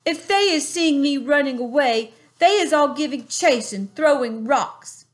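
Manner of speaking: a hint of a big friendly giant; lightly voiced kind giant. A narrator's reading. BFG answering Sophie, a lightly voiced giant.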